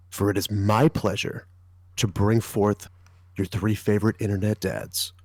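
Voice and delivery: movie trailer voice; mock official announcement. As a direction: epic trailer narrator intro. Movie trailer style podcast intro.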